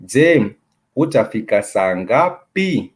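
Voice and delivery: slow Swahili question, pitch lifting on "ngapi"; rising question intonation. Shown as a demonstration. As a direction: let NGAPI climb high at the end. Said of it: "The intonation goes high".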